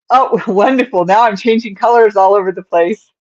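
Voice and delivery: laughing, high and loud; sarcastic laugh. Real, not acted. Sarcastic at color mistake.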